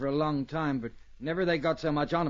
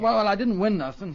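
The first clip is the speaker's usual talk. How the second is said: louder protest; 5 semitones higher, 7 dB louder than usual. Acted. Rocky insisting to the marshal he won nothing, louder protest. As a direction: raise the voice defensively.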